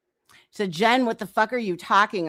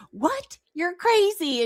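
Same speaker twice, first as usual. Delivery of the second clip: high, disbelieving squeal of a quote; mock incredulous; 8 semitones higher, slower than usual. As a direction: play her shocked reaction. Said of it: "she was like".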